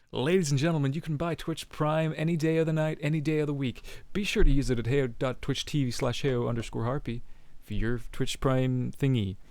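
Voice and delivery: tongue-in-cheek stream ad read; mock announcer plug. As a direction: sell it like a cheesy announcer. Streamer plugs Twitch Prime.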